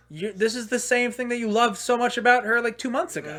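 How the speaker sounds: high, disbelieving retort; incredulous. Retold or imitated. To his ex, high and disbelieving.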